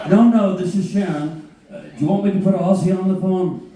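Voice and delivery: a mock Sharon on the phone. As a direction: play Sharon, upbeat and businesslike.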